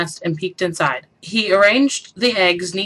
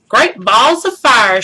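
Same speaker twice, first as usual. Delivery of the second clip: slow drawn-out Southern goose exclaim; 4 semitones higher, 7 dB louder, slower than usual. A narrator's reading. The goose marvels at the nest, drawn out. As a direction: belt it like a folksy goose. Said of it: "she cried".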